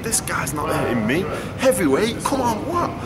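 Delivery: mock-baffled, shrugging off the punches; cocky disbelief. Boxer retelling his thoughts on the ropes, cocky disbelief.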